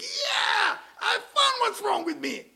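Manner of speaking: high, loud cheering shout; excited shout. Pretend. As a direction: yell it like you just won. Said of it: "start dancing and get excited".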